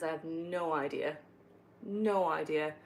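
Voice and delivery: usual speaking voice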